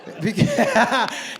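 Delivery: ordinary talk, nothing marked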